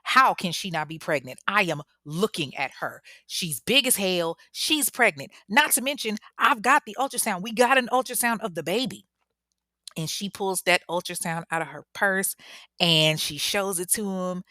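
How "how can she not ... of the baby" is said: imitation of an irate mama; popping off. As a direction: argue back, ramping up as you go. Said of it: "ready to pop off at this doctor".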